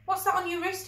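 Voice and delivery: a bully amazed and wanting answers; lightly voiced pushy kid; demanding schoolboy Callum. A narrator's reading. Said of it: "he demanded".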